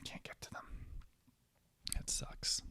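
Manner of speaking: soft unvoiced whisper to herself; whispered wish for snacks. Real, not acted. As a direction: mutter it under your breath while reaching. Quiet muttering while reaching for food.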